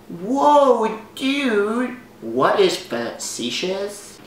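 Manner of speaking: high, drawn-out mock amazement; puzzled listener. Voicing what the audience is thinking, puzzled dude. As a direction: play a baffled dude. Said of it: "If you're thinking right now".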